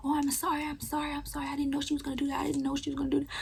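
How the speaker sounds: high, soft, pleading apology; apologetic mom. Retold or imitated. Mom apologizing to grandmother, high and soft. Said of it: "very apologetic to her mama".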